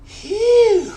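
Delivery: loud, high puff of exhaustion; big exhale. Real, not acted. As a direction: blow out hard, spent. Exhale after heavy set.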